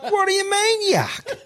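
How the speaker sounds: high incredulous question through laughter; laughing disbelief. Retold or imitated. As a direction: retell his own outrage, cracking up. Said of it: "And I'm like".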